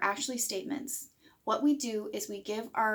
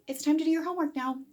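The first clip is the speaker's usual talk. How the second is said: higher and quick, friendly "It's time to do your homework now"; nice kind tone; 6 semitones higher, faster than usual. Shown as a demonstration. Parent telling the child kindly, friendly. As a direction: say it kindly, not mean. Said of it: "we can say this nicely, and tone matters".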